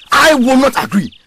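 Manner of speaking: loud, firm refusal; defiant. Acted. Insisting during protest talk.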